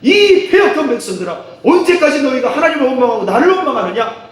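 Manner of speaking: high, loud angry rebuke, acted. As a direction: scold the crowd as an angry prophet. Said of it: "뭐라고 화를 냈느냐?". (화내며 꾸짖는 흉내)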